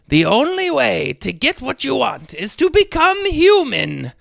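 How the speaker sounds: a lightly voiced sea witch, sly; sea witch explaining. A narrator's reading. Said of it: "Ursula explained".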